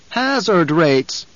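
oily agent voice. Acted. Voicing oily Guild agents.

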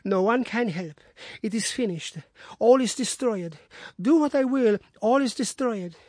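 high, strained lament of a broken woman; despairing wife. Acted. Distraught wife telling Watson all is destroyed, high and pained. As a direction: cry out that all is lost.

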